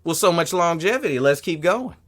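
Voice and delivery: lightly mocking; sarcastic comic brushing off a heckler. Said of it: "He said".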